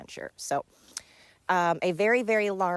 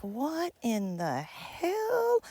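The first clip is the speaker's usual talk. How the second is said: drawn-out, high incredulous question; baffled disbelief; 6 semitones higher, much slower than usual. Retold or imitated. Looking at a giraffe or an ostrich, slow baffled disbelief. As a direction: stretch every word slowly in comic amazement. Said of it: "and I'm like".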